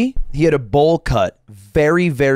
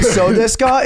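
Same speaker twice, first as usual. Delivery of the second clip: higher mock Japanese accent; 6 semitones higher, slower than usual. Shown as a demonstration. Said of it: "is a better Japanese accent".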